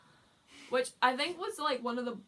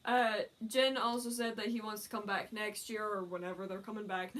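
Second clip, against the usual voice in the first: ordinary talk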